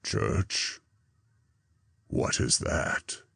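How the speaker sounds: low, grumbling dog voice; sullen fire dog. A narrator's reading. The fire dog sullenly interrupts Zarathustra, low growl. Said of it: "interrupt me sullenly and asked".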